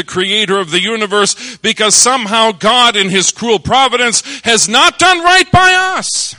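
rising sermon crescendo; preaching at full force. Acted. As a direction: preach it, climbing to a roar.

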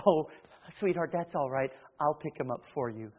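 mock sweet indulgent mother. Indulgent mother voiced softly.

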